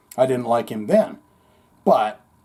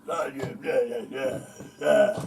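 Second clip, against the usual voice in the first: put-on drunk mumble; 5 dB quieter than usual. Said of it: "he seems drunk or something".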